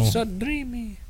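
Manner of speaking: high, slow mock swooning voice. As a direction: copy her lovestruck sigh, slowly. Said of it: "what voice was that".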